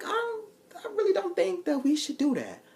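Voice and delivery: high, lightly voiced [name]; hesitant [name]. [name] turning down the date. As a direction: hedge and back away.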